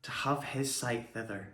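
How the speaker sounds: soft, halting verse fading almost to a whisper; subdued Shakespeare monologue. Acted. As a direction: let the speech die away softly, with long pauses.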